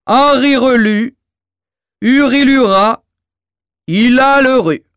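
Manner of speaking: the giant intones the magic words; dim giant reciting. Acted.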